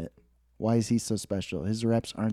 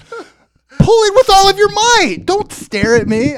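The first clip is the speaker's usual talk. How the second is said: high, loud, slow goofy panic; panicked plea; 12 dB louder, slower than usual. Retold or imitated. Stuck in magnets, begging coworker in goofy panic. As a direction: plead in comic desperation. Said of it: "panic in my face".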